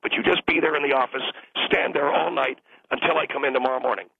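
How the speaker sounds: ordinary delivery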